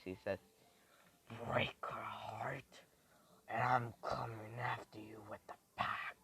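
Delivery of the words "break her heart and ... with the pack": slow, whispery devil's threat; menacing devilish rasp-whisper. Acted. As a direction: half-whisper it like a devil, drawn out. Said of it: "says this in a devilish voice".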